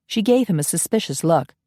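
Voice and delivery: plain reading voice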